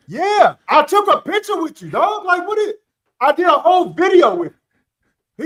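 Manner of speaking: insist, incredulous and loud; indignant. Retold or imitated. Insisting he was there.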